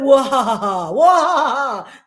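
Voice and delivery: hammed-up triumphant cackle; mock evil laugh. Mocking the sister with a gloating cackle.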